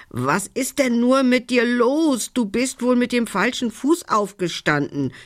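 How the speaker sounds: lightly voiced raven, pitched high; a high, scolding raven with a hint of croak. A narrator's reading. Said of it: "krächzte er".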